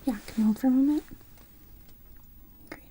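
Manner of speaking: ordinary talk, nothing marked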